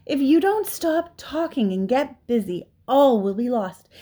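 frantic piglet warning; desperate. A narrator's reading. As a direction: plead as if all is lost. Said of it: "in desperation".

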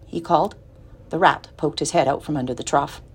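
plain reading voice

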